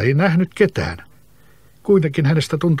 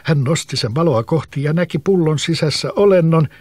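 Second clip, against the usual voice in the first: ordinary delivery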